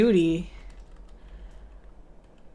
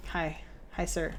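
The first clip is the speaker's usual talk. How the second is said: ordinary delivery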